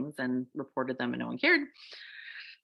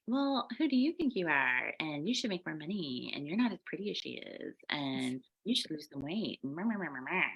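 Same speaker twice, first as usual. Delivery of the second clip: a softer, lightly voiced nagging critic; nagging inner voice; 5 dB quieter than usual. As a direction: mutter doubts like the inner ego. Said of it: "little voice that's like".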